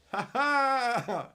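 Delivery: loud, high burst of laughter; big laugh. Real, not acted. Streamer cracking up at his own bit, loud laughing.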